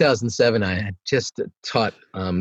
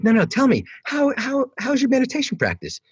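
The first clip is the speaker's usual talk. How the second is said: much higher, pleading insistence; mock insistent pleading; 12 semitones higher than usual. Pressing a student about his practice, high mock pleading. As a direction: beg him to answer, hammed-up.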